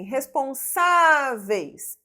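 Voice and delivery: "responsáveis" with a long, loud SÁ; stressed syllable demonstration. Shown as a demonstration. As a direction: slowly hold the SÁ in "responsáveis". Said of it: "nós temos uma paroxítona".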